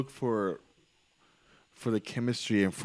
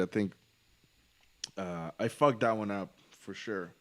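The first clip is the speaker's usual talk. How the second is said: usual speaking voice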